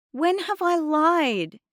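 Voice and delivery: high, loud, drawn-out protest; indignant challenge. A narrator's reading. Girl accused of lying by Mum, indignant. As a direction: demand it, stung.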